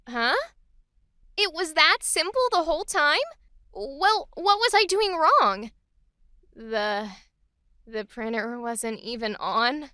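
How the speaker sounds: puzzled question sinking into a sheepish admission; clueless charming newcomer. Acted. New worker finds printer was off, cutely clueless. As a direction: realize your silly mistake, then own it lightly. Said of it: "let's create a neutral line for her".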